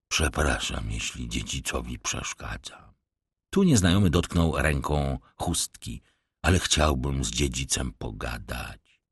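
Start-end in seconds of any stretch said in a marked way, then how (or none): 0.1-2.9 low, soft mock-humble tramp; a sly beggar bowing with false politeness; rough vagrant voice. Vagrant feigning deference to the young master, low and soft. Stranger in red kerchief speaks. 6.4-9.0 low, soft mock-humble tramp; a sly beggar bowing with false politeness; rough vagrant voice. Vagrant feigning deference to the young master, low and soft. Stranger presses his request.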